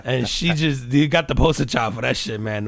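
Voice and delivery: usual speaking voice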